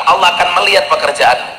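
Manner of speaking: normal talking voice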